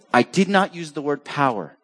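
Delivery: ordinary talk, nothing marked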